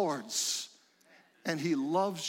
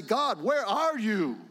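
higher, slow plea to God; acted calling out; 5 semitones higher, 3 dB louder, slower than usual. Preacher voicing a struggling believer, mildly calling out. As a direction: call out as if searching for God. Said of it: "at times you call out and say".

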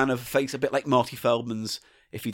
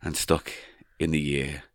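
too pausey; 7 semitones lower than usual. Acted. Reading a Kmart story aloud, too pausey. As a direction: drag it out, pausing between phrases. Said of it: "Too pausey now. It's too pausey".

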